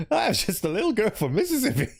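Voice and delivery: put-on high, sweet Mississippi girl accent; Southern accent. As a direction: play an innocent little Southern girl. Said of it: "I have access to accents".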